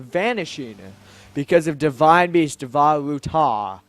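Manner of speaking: stately monarch, punched openings and falling phrase ends; grand Zora king, solemn. Acted. King informing the hero of danger, grand and solemn. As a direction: declaim it like a formal royal plea.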